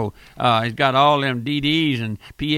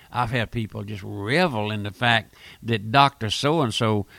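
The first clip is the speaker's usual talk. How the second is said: ordinary talk, nothing marked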